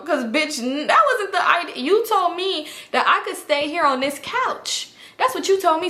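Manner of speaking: high-pitched mock outrage; high-voiced acted argument; retold indignation.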